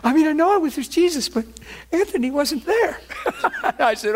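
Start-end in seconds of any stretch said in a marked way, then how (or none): 0.0-3.0 high voice quoting wife. Retold or imitated. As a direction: play the wife teasing, pitched high. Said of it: "She said".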